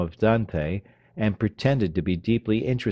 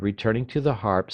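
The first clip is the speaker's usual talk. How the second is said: ordinary delivery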